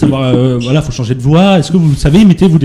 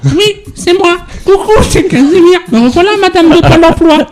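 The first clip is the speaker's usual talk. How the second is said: high, cheery TV monster voice; Casimir; 11 semitones higher than usual. Acted. Casimir reporting at the job centre, high monster voice. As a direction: pipe up like a children's character. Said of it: "t'imagines Casimir arriver au Pôle emploi".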